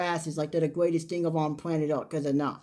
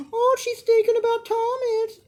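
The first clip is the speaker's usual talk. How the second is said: much higher mocking fan voice; 14 semitones higher than usual. Mocking Hope and Thomas fans, high mock voice. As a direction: parody a hopeful fan. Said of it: "fans can go".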